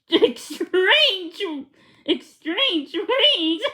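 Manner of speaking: giggling through the words, slow; laughing so she can barely get it out; words broken by laughter. A narrator's reading. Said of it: "She started to laugh".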